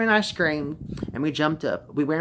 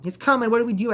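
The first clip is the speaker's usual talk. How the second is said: higher, louder cry of panic; frightened boy yelling to his brother; panicked yell; 8 semitones higher, 5 dB louder, faster than usual. A narrator's reading. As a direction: shout for help in terror. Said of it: "I yelled".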